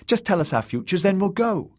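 put-on high, pleading young girl; begging girl. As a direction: plead like an anxious child. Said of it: "begged Malara".

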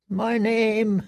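high, choked words; words caught in a rising scream; strangled fear. A narrator's reading. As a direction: prisoner chokes on his own name. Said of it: "a scream caught in his throat".

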